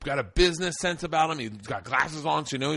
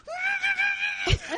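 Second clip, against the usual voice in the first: high squealing held-in laughter; imitated stifled laugh. Clayton trying to hold it in, high stifled laugh. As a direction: mimic someone failing to hold in a laugh. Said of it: "he's trying to hold it in".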